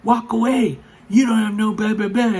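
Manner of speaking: high, loud taunt of an angry woman; imitated yelling. Angry mother jeering as he walks away, high mock yelling. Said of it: "yelling stuff at me".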